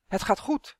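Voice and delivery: ordinary delivery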